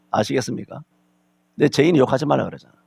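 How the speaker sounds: ordinary talk, nothing marked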